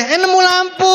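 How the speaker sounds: high, loud, hammed-up surprise at a lucky find; silly, amazed finder of a lamp; mock surprise. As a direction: squeal like you tripped over a lamp on the street.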